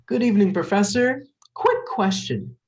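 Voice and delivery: high, bright, nervously cheery hello; overly cheerful to mask nerves; chipper greeting. Acted. Cheery phone greeting to boss.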